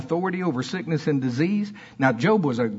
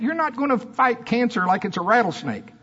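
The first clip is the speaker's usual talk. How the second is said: usual speaking voice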